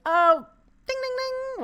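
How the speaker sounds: high sing-song ding-ding-ding; mimicked bell ding. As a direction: chime it high like an alarm bell.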